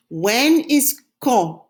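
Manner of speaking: When is quand, stretched slowly; slow, clear. Shown as a demonstration. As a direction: teach it slowly. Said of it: "Say it after me".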